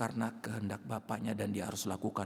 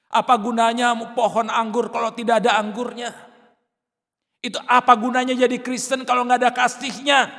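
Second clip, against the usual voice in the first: loud, high, building rhetorical questions; preacher rising to a shouted climax; fiery preaching; 11 semitones higher, 13 dB louder than usual. Real, not acted. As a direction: drive each question home harder.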